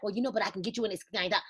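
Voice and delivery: quick, soft and low, like a nervous seller; mock timid lowball pitch. Coach mocking sellers who offer a cheap deal, hurried and soft. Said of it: "then be like".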